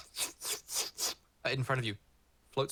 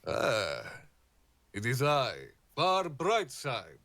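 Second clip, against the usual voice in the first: party bugbear; slower than usual. Acted. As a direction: announce yourself like a showman. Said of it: "a bugbear stepping out".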